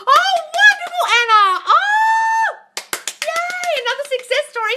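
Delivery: squealing high with joy and a laugh; overjoyed cheer for a listener; high, loud happy squeal; delighted. Real, not acted. Delighted at a viewer's success.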